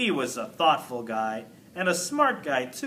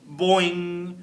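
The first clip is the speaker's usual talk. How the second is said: ordinary delivery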